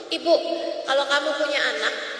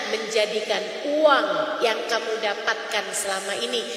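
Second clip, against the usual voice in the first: gentle exhortation; 6 semitones lower than usual. Acted. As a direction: ease them gently into the prayer. Soft closing exhortation.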